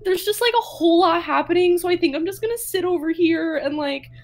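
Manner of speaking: a jittery Morty who is scared to come over. Acted. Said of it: "he's like Morty".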